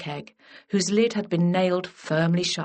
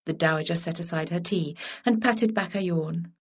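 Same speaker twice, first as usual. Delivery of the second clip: ordinary delivery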